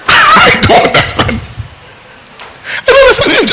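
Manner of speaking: high, squealed disbelief; laugh-tinged, very high-pitched bafflement; utterly baffled, voice leaping up high. Acted. Mock bafflement at such strife.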